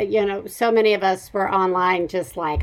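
ordinary talk

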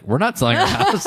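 high, baffled homeowner voice; confused homeowner. Retold or imitated. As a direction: sound puzzled and high. Neighbor confused by sign.

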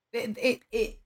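normal talking voice

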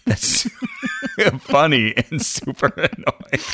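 laughing through words. Real, not acted. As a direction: giggle while talking. Laughing at own answer.

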